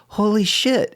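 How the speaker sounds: high, stunned holy shit; amazed. Retold or imitated. Retelling the night the critic reviewed his show, stunned. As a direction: gasp it out in disbelief. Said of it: "and I'm just like".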